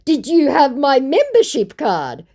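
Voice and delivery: high, loud, silly scammer pitch; hammed-up sales question; put-on scammer voice. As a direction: play an eager scammer up high.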